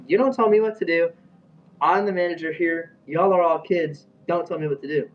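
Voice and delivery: high, loud retold telling-off; mock an authority figure pulling rank; imitated angry manager. Retold manager snapping back at teller.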